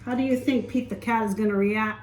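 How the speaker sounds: usual speaking voice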